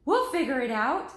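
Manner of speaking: loud, breezy reassurance; confident reply. Acted. Annie answers Jack while running: loud confident reply. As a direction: brush off his worry.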